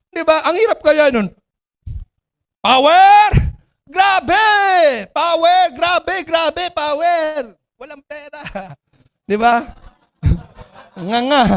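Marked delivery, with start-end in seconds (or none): 2.6-7.5 high hype cries, fading in volume; mock hype shouting. Recruit with no results chanting empty slogans, mock hype shouting. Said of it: "yung pag sumisigaw ka".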